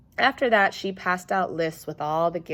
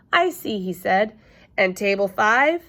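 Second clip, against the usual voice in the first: innocent teasing; 4 semitones higher, 6 dB louder than usual. Acted. Sheldon teasing question.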